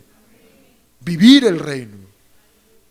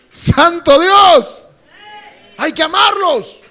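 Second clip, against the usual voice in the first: very loud, high, slow cry of exasperation; drawn-out shouted "Santo Dios"; loud exclamation; 9 semitones higher, 7 dB louder, slower than usual. Real, not acted. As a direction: exclaim to heaven in dismay.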